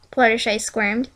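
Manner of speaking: plain reading voice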